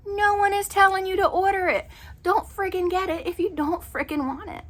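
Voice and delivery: really fed up, pitch jumping up; exasperated. Real, not acted. Exasperated rant about veggie burgers.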